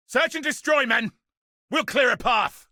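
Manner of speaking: rally the men into the fight; commanding battle cry. Acted.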